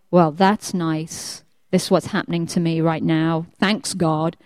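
low, sullen mock thanks; sarcastic grumble. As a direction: grumble it low like someone blaming God. Mimicking people blaming God for troubles.

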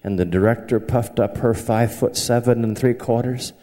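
usual speaking voice

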